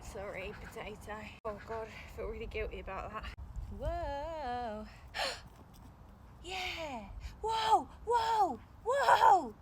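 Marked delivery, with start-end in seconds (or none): 3.8-9.5 excited whoas. Real, not acted. Finding many potatoes.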